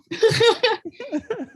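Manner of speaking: high giggle, falling away; embarrassed laugh. Real, not acted. Joking about their own French accent, high laugh. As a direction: giggle at your own expense.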